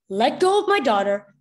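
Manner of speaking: loud, protective father; angry demand. A narrator's reading. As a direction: demand her release. Father confronts officer holding daughter.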